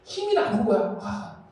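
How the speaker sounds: ordinary talk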